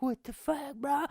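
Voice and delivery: high, disbelieving what the fuck; stunned outrage. Retold or imitated. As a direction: react like you cannot believe it. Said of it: "and I was like".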